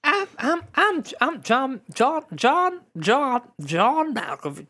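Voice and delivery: high, slow, stuttering Malkovich; John Malkovich. Retold or imitated. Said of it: "do a John Malkovich impression".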